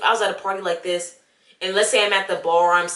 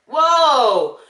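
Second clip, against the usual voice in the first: startled whoa; 6 semitones higher, 7 dB louder than usual. Retold or imitated.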